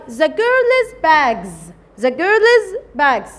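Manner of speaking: very slow, word by word; teacher dictating the English sentence very slowly; slow dictation. Shown as a demonstration. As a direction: spell it out slowly for the class.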